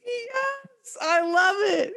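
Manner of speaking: squealing laugh, very high; cracking up with delight. Real, not acted. Laughing at absurd product.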